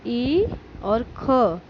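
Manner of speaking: slow letter-by-letter spelling; teacher spelling it out; spelled out slowly. Shown as a demonstration. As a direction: sound out each letter slowly. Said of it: "आप इस चैप".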